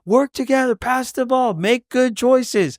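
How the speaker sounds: high, drawn-out sideline instructions; imitated coaching yell from the bleachers; retold courtside shouting. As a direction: call out to the team like a worked-up dad. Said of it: "so I'm shouting".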